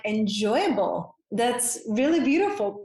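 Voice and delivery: usual speaking voice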